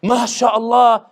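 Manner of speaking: loud, slow, high delighted MashaAllah; impressed praise. Retold or imitated. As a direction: gush it like an amazed local.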